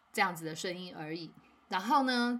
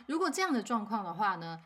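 usual speaking voice

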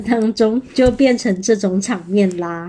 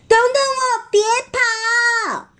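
pitched-up pursuer's cry; chasing wolf; 12 semitones higher than usual. Acted. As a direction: wail after the runaways in storybook fun. Wolf chases after friends, calling.

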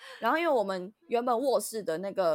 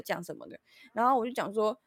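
ordinary delivery

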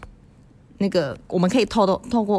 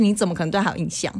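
ordinary talk, nothing marked

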